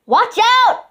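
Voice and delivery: sudden high alarmed shout. A narrator's reading. Duke warning everyone after the bang, high loud shout. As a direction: yell a warning. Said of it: "the Duke shouted".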